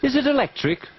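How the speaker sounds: high question. Acted. Character asks in parody.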